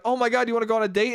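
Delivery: fast, eager rush of words; excited. Retold or imitated. Younger self eagerly asking for a date, fast rush. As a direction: tumble out the question fast. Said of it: "And I'd be like".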